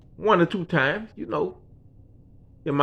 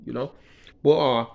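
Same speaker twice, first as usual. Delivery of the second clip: ordinary talk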